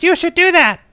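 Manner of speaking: squeaky high mock female voice; high falsetto Heather. Dubbing his cousin Heather's words in a mock high voice. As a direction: pipe it up high like a cartoon cousin. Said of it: "Heather says".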